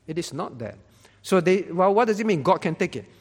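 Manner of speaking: usual speaking voice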